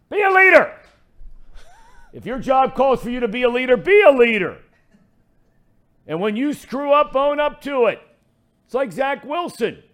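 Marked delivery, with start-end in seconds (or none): 0.1-7.9 real shout, high and loud; yelling. Real, not acted. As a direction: bark it like a coach demanding leadership. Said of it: "wants to know who I'm yelling at".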